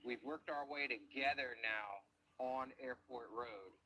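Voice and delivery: usual speaking voice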